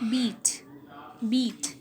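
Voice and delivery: "beat" said singly with a long ee vowel; example word. Shown as a demonstration. Teacher giving an example of a front vowel, "beat" said carefully alone. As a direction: set each "beat" apart to show its ee. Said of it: "as in".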